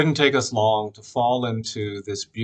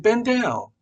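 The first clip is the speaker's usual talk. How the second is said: much higher, louder repeated command; insist, higher, like an older woman; old woman's insistence; 11 semitones higher, 6 dB louder than usual. Retold or imitated. Older woman repeating her order, higher and insistent. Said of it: "she said it again".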